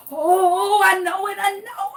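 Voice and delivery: high, straining I-know-it whine; eager girl. Retold or imitated. Imitating the know-it-all girl begging to answer, high and straining.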